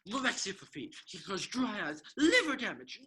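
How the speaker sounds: loud high frustrated shout. Acted. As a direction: yell it out in exasperation. Rattling off lines in frustration.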